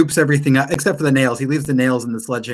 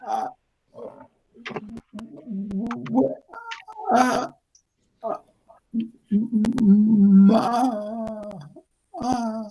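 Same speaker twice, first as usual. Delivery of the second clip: flustered stammer; 8 semitones higher, 4 dB quieter, much slower than usual. Acted. Blacksmith stammering.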